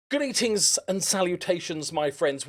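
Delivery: slow, grand "Greetings and salutations"; grand vlog greeting. Acted. Youtuber greets his friends, slow and grand. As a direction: greet the viewers like a showman.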